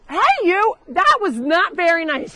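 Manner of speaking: high, loud telling-off of a naughty goat; sharp mock-stern scolding; scolding shout. Real, not acted. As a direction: yell at the goat to stop it. Scolding a goat that bit someone.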